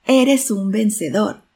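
loud, slow echoed shout; resounding echo. A narrator's reading. Mountain echo answers the father, loud resounding shout. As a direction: send the call back loud and drawn out. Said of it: "Y la voz resonó".

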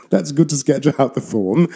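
amused and laughing as she speaks. Real, not acted. Laughing while answering the reader.

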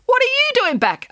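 high, loud shocked rat; the rat alarmed to see the pig return; startled rat. A narrator's reading.

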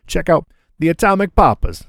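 playful Italian accent with a flourish. Shown as a demonstration. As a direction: hype it up in a mock Italian accent. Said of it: "with an Italian accent".

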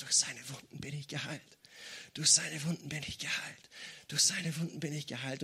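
soft, low murmur imitating a whisper; retold weak whisper. Sick woman answering the voice in her head, whispered back softly. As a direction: repeat it quietly, like someone too weak to speak up. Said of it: "Sie hat einfach nur zurückgeflüstert".

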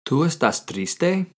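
slow Spanish question rising on triste; teacher modelling the question slowly; slow model question. Shown as a demonstration. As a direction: ask it slowly, lifting at the end. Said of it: "How do you ask someone, "Are you sad?".

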